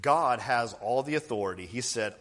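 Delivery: ordinary talk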